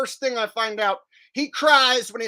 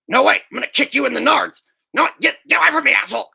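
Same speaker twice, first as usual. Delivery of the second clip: loud, higher, fast panicked yelling; Beavis impression; 5 semitones higher, 6 dB louder, much faster than usual. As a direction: squeal and scramble away in mock panic. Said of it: "save that impression".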